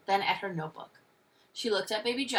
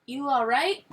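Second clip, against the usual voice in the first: halting, higher-pitched question; hesitant start, then a louder, rising finish; shy; 5 semitones higher, 6 dB louder than usual. A narrator's reading. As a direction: stammer shyly into the question. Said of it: "she asked shyly".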